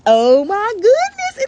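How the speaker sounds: high, slow, loud gasp of wonder; astonished, drawn out; amazed cry; surprised. Acted. Beto sees his grandmother.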